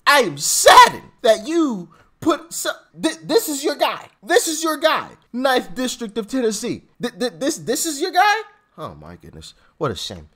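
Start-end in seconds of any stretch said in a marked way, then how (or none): 0.0-8.3 louder, pressing each question hard; emphatic indignation. Real, not acted. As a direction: demand an answer, incredulous. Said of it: "put some emphasis on that".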